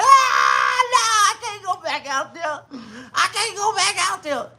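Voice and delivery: high, hammed-up sobbing; mock crying granny. Granny refusing the kids while mock crying. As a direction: sob it out like a beaten granny.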